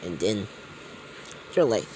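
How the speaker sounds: normal talking voice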